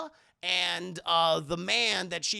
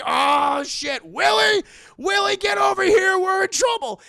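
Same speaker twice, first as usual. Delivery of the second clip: much higher, much louder desperate yelling; panicked shout; 13 semitones higher, 13 dB louder than usual. Acted. Indy screaming for Willie to come help. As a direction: yell for help through a wall.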